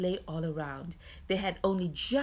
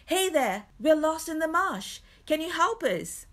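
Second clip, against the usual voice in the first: calling out high and loud, overjoyed; joyful hail; 10 semitones higher, 6 dB louder than usual. A narrator's reading. Lost traveller calling to the lantern, joyful shout. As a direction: hail a distant lantern with relief. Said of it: "hailed the light with joy".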